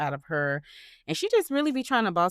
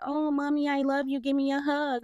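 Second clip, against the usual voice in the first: put-on high, sing-song child voice; pleading kids; 8 semitones higher than usual. Twins coming home wanting mom's hug, imitated high child voice. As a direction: imitate clingy children begging mommy for affection. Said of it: "When they be like".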